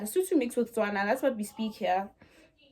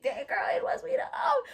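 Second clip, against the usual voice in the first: high-pitched mock insistence; retold protest; 14 semitones higher than usual. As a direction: squeal it back in mock defence. Teens protesting to scolding mother.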